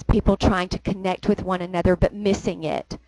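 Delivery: ordinary talk, nothing marked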